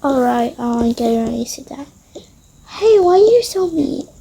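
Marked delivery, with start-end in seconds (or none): none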